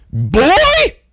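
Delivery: high piercing shout; woman shouting. Retold or imitated. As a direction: belt it out like an angry woman. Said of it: "a woman's voice shouting very loudly".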